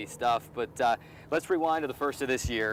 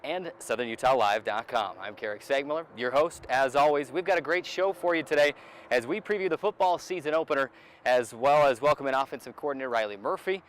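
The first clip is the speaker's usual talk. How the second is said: upbeat, louder host intro; show intro read; 3 semitones higher, 5 dB louder than usual. Acted. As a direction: sell the show to the viewers. Host opens the sports show.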